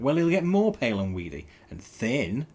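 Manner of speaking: triumphant. A narrator's reading. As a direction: clinch the argument. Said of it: "Tails said triumphantly".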